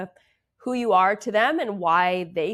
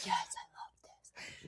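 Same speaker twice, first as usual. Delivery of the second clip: whisper; 15 dB quieter than usual. Retold or imitated. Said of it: "you're, you're like".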